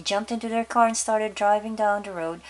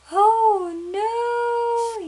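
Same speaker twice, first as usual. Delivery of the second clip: high, loud yelled oh no; 8 dB louder than usual. Acted. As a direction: yell it in alarm. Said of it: "yelled the father".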